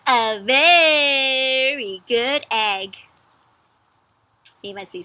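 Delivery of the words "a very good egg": "very" drawn out long, louder; stretched very. Shown as a demonstration. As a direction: read "very" super long. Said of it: "Can you say that with me".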